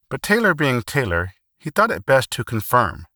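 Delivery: ordinary delivery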